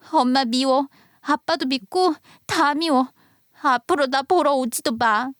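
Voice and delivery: put-on high, tearful child lashing out; crying tantrum. Daughter lashes out at her absent mother, tearful and angry. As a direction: scream at mom through tears. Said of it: "화를 내며 처음으로 악을 쓰듯 우는".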